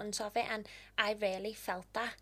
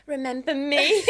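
louder, higher pretend jab at the woman; mock taunt; 4 semitones higher, 10 dB louder than usual. Imagining a TikTok aimed at the woman who doubted her, a loud mock taunt. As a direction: throw it back at her like a playful threat. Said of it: "like, TikTok, like".

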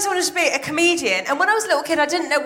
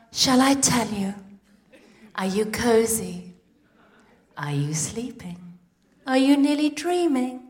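soothing bedtime voice; 3 semitones lower than usual. Pretend. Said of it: "lulling daughter to sleep".

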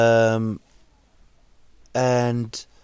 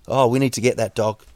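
ordinary talk, nothing marked

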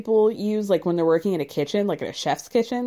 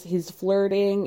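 ordinary talk, nothing marked

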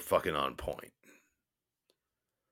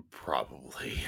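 soft breathy whisper; 5 semitones lower, 5 dB quieter than usual. Real, not acted. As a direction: whisper it as an aside. Whispered aside.

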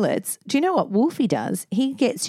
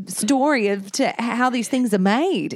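ordinary talk

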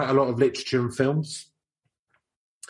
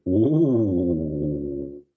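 long, drawn-out ghostly "ooh"; mock-spooky ooh; 5 semitones lower than usual. Co-host reacting to the name Lucifer magazine, mock-spooky ooh. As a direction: draw out a playful ooh, sliding down.

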